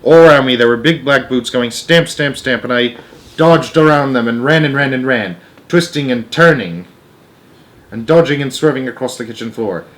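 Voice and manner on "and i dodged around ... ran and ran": usual speaking voice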